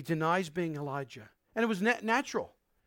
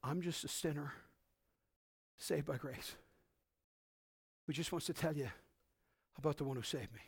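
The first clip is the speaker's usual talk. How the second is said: humble hushed confession; 5 semitones lower, 11 dB quieter than usual. Retold or imitated.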